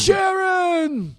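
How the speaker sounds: yelling Sharon, very high; [name]. Retold or imitated. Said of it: "I also did an Ozzy impression".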